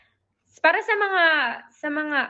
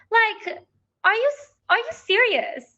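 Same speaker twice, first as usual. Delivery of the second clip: incredulous; 10 semitones higher than usual. Real, not acted. Incredulous at the lugaw trend.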